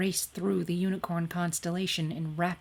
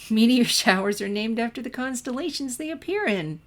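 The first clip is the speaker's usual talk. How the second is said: still giggling; 6 semitones higher, 5 dB louder than usual. A narrator's reading. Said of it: "still giggling".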